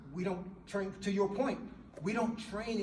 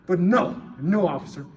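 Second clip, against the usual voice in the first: defiant suspect; 10 dB louder than usual. Pretend. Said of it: "he knows policy is I gotta sit up".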